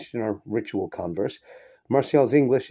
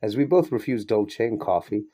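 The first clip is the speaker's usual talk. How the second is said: plain reading voice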